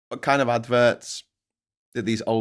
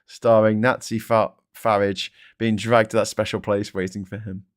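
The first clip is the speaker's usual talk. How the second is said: normal talking voice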